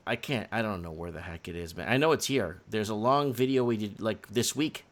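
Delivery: ordinary delivery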